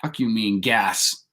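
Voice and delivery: ordinary delivery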